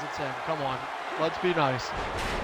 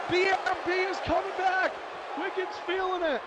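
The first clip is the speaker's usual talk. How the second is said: much higher, rising to a near-shriek on the reversal; excited play-by-play; 9 semitones higher than usual. Real, not acted. Commentator calling a stunning reversal to viewers, high excited pitch. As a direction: call the comeback like you can't believe it.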